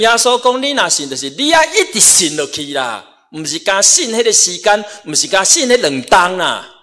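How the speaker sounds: high, loud preacher's exhortation; forceful preaching. Real, not acted. Preaches with raised voice.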